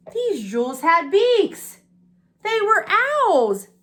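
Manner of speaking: wonder-filled reveal. A narrator's reading. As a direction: draw out the surprise. Story reveal, slow and high.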